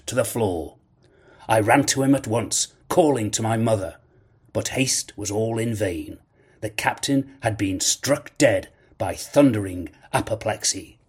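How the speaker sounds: ordinary delivery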